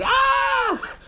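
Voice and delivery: very high-pitched mock scream. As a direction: imitate a horror-movie scream without extra volume. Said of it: "she starts screaming".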